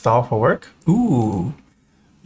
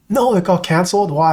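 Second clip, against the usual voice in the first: surprised; 6 semitones higher than usual. Real, not acted. Hears trip got canceled.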